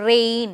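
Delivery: "റെയിൻ" with the "ay" drawn out; long AY vowel demo. Shown as a demonstration. Teacher asking students to pronounce it, "റെയിൻ" with a held ay. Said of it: "ഒന്ന് pronounce ചെയ്ത് നോക്കിക്കേ".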